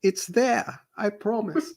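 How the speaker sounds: a half-hearted South African accent; tried South African accent. Shown as a demonstration. Game master tries player's South African accent, half-hearted. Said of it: "can't do it".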